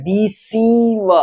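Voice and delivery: डिसीव slowed, the सी held long; long ee in deceive. Shown as a demonstration. Teacher showing the held ee of डिसीव. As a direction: linger on the सी of डिसीव. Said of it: "उस पे कुछ देर रुकना है".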